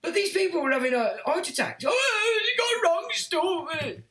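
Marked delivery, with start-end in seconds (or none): 1.8-4.0 high, slow outraged whine; mock complaining. Streamer mimicking customers moaning about deliveries, high slow whine. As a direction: play a customer up in arms over a delivery.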